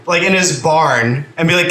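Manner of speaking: normal talking voice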